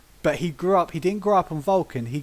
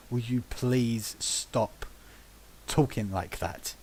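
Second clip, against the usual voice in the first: slow, low, weary begging; pained plea; 6 semitones lower, 8 dB quieter, slower than usual. Retold or imitated. Group members beg a player to drop his voice, slow and low. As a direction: beg it slowly, at the end of your patience.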